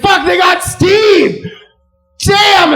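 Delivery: high, loud drawn-out shout; panicked yell. Pretend. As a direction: scream as the herd scatters. Gazelles panic as Steve grabbed.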